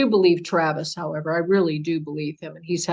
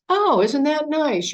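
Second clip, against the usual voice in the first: a sugary, slow, higher mock-pleasant line; mock sweet reply; 8 semitones higher, 4 dB louder, slower than usual. Mocking the polite reply she didn't give her mother, sugary and slow. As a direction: play the calm answer she never gave, dripping fake niceness. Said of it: "instead of, you know, saying".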